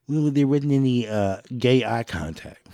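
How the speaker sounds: hammed-up complaining critic, slowing at the end; mock critic. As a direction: sneer as you imitate the whiner.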